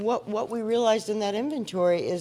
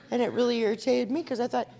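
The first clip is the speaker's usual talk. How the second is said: ordinary talk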